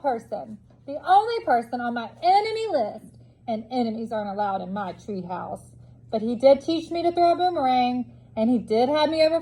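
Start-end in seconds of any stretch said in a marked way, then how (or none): none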